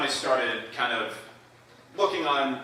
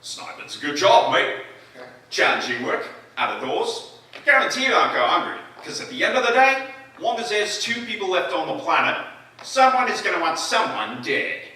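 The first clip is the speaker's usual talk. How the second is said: put-on higher and louder, an easygoing Australian accent; Australian sniper; 9 semitones higher, 7 dB louder than usual. A sniper explaining his job, in an Australian accent. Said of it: "the Australian sniper".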